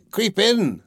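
high-pitched witch's order; storybook witch, pitched well up; coaxing witch. A narrator's reading. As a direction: coax the child in with a high witch voice. Witch coaxing girl into oven.

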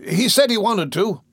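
put-on eager tattling boy. Noah voiced.

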